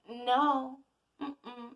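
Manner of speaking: a lightly voiced cosmic no; the energy refusing. The energy blocks you, playful refusal. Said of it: "the energy goes".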